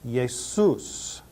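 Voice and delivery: Jesus as YEH-soos with a y sound; Jesus said the Greek way, ye-soos; softly sounded Jesus, Greek style; Greek pronunciation. Shown as a demonstration. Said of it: "This is the word for Jesus".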